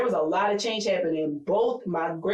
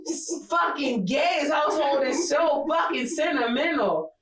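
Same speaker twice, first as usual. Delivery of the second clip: mock exasperated shout; 6 semitones higher, slower than usual. Real, not acted. Telling her friend about her sentimental family, slow mock-outraged shouting. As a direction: complain with a grin.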